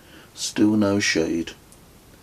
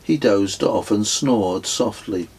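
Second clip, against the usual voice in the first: ordinary delivery, nothing marked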